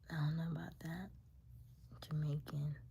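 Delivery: a sceptical hushed aside; whispered doubt. Real, not acted. Telling viewers she doubts the match, whispered. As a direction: whisper it, half to yourself.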